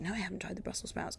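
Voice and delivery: fast breathy whisper; quick whispered reply. Retold or imitated. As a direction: rush it out under your breath. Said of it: "And they'll be like".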